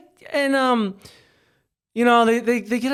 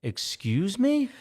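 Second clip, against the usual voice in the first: slow, softer, drawn out disbelief; incredulous; 7 semitones higher, 4 dB quieter, much slower than usual. Retold or imitated. Answering the teen's lip, slow and incredulous. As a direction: hold it out in disbelief. Confronting the rude kid.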